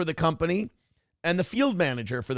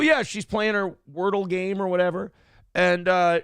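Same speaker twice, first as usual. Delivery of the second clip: ordinary delivery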